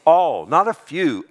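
"All" punched loud and slow; preacher stressing "All"; emphatic ALL. Shown as a demonstration. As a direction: drive home "All" with full force. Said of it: "and what?".

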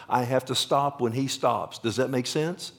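ordinary delivery

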